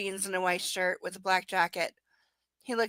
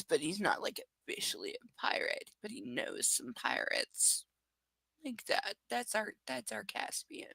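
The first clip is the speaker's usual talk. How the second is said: whisper; 6 dB quieter than usual. Pretend. Hushed secret-telling about the duck.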